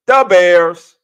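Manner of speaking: loud, high Chicago superfan bellow; Chicago fan catchphrase. Retold or imitated. Host imitates how Chicago says it, loud Chicago bellow. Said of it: "That's how they say it".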